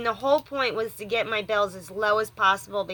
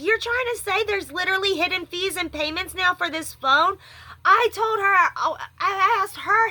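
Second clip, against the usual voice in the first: higher, agitated complaint; upset rant; 6 semitones higher than usual. Real, not acted. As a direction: vent the outrage at the company. Upset rant about phone fees.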